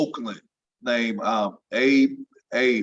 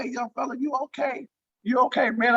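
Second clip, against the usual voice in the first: concerned call; 10 semitones higher than usual. Retold or imitated. Wilt checking on him.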